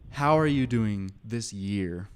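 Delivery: normal talking voice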